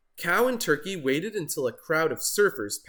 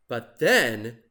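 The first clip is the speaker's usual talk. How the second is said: usual speaking voice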